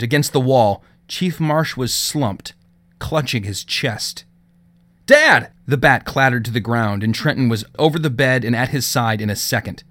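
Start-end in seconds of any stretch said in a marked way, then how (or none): 5.0-5.5 loud, high cry of alarm. Acted. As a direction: shout in sudden fear. Finds father collapsed, cries out.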